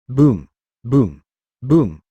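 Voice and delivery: three booming impact sounds; loud booms. A narrator's reading. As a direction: shout each boom loudly. Repeated blows on the head.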